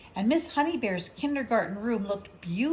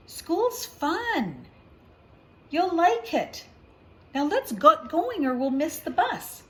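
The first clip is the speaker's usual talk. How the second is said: high, louder, upbeat push; eager big brother; 6 semitones higher, 4 dB louder than usual. Acted. Big brother urging his worried sister onto the bus, excited. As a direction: hurry her along with excitement.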